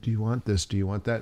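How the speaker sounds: hushed, quick little offers; softly imitating the attendant's hushed questions; mock whisper. Retelling hushed flight attendants, softly imitating their whisper. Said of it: "whispers things".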